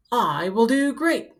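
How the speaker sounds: usual speaking voice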